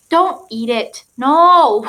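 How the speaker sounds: sharp loud no; firm command. Real, not acted. Scolding her dog off the prop, firm and loud. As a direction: stop the dog mid-sentence.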